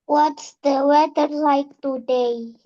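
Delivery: falling tune on "today" after correction; corrected falling tune. Real, not acted. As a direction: repeat it with the voice going down. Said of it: "Ya, itu dia, betul".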